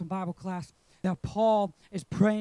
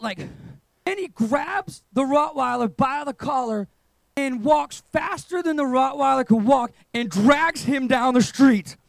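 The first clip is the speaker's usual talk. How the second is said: normal talking voice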